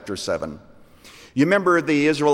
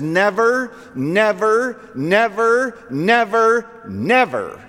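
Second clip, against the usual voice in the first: each never climbs higher and louder, last one falls; building repetition; 9 semitones higher, 3 dB louder, slower than usual. Acted. God's fivefold promise, building.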